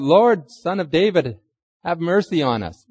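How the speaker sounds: mock crying out. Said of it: "And they shouted it out".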